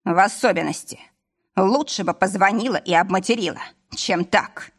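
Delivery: plain reading voice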